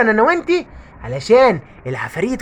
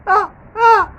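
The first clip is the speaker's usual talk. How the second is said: high pained scream; 10 semitones higher, 5 dB louder than usual. Acted. Ghost screams in pain.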